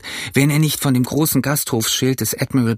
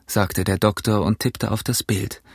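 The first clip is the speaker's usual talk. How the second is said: usual speaking voice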